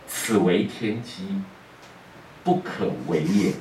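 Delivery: slow, low pronouncement; solemn sage. Acted. Guan Lu refusing the father in a slow, low pronouncement. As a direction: intone it like an oracle.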